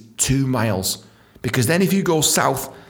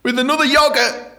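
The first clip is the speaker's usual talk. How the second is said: high, exasperated outburst; exasperated gripe; 14 semitones higher, 3 dB louder than usual. Acted. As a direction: gripe loudly about being overcharged. Griping at holiday ice-cream price.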